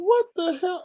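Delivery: high disbelieving outburst; shocked. Retold or imitated. Vlogger's high shocked reaction to the streamer. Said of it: "I'm like".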